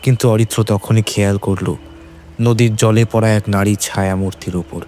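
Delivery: plain reading voice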